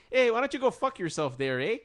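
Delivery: high, loud mock Canadian accent; friendly Canadian lilt saying something rude. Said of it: "that kind of accent".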